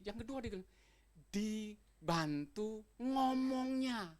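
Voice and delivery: normal talking voice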